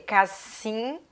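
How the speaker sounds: "cassina" split CAS-SIM... NA, S instead of Z; beginner's misreading. Retold or imitated. Child trying to read casinha, beginner's misreading. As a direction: sound out cassina in separate syllables with plain N. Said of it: "não dá pra fazer".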